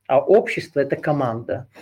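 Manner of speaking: ordinary delivery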